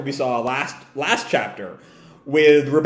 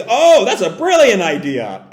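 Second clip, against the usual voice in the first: higher, louder grinning exclamation; light up at a scheme with a grin; delighted, rising burst; 5 semitones higher, 6 dB louder than usual. Acted. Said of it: "Ziggy grins".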